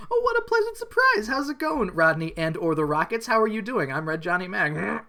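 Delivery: high, falsetto greeting that sinks back down; hammed-up cheery promoter voice; squeaky fake-friendly welcome to the band. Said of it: "[name] and the Rockets arrive".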